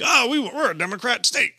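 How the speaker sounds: high-pitched whiny mockery; mocking Jersey. Comic voicing New Jersey as a prim state, mocking. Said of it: "Jersey was like".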